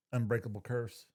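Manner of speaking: ordinary delivery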